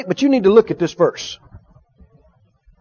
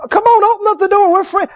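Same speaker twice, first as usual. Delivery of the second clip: higher-pitched, pushy caller at the door; coaxing stranger; 8 semitones higher than usual. Acted. Unfamiliar caller begs to be let in, coaxing. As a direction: urge them in a raised pitch. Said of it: "an unfamiliar voice comes back".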